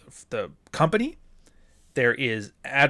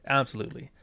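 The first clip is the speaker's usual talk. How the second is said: usual speaking voice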